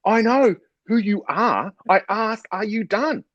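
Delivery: high, exasperated retold outburst; slow, high-pitched mock indignation; angry staff. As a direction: imitate an instructor losing patience, pitch way up. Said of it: "who's getting quite angry".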